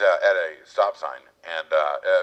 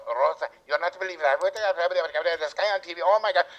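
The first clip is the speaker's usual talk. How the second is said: excited lady on the phone; 9 semitones higher than usual. Retold or imitated. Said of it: "she's on the phone".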